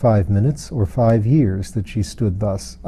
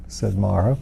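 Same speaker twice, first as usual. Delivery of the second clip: ordinary delivery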